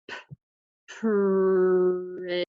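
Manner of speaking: P, then pretty drawn out slowly; sounding out words. Shown as a demonstration. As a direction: tap out pretty starting with p. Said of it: "use my letter sounds again to tap out this word".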